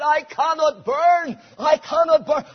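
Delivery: retold desperate cry, high and loud. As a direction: cry out as a man facing the stake. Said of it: "he cried out".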